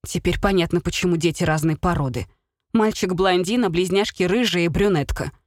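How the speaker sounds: ordinary delivery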